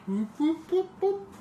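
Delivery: a spaceship taking off, whooping up; mock siren whoop. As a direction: whoops climbing high into falsetto. Imitated siren or spaceship noise.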